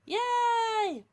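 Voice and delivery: high, bright little cheer of delight; excited yay. Real, not acted. Cheering a friend's new readathon, excited. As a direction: squeal it happily.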